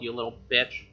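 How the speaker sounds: ordinary talk, nothing marked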